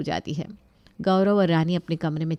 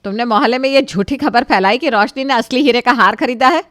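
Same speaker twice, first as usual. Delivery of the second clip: angry accusation; 5 semitones higher, 8 dB louder than usual. Acted. Accusing Rani.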